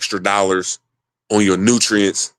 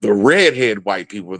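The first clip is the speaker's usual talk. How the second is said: normal talking voice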